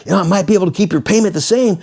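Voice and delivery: high, fast, slick salesman pitch; car salesman. Retold or imitated. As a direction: sell it like a pushy car dealer. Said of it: "there's my salesman".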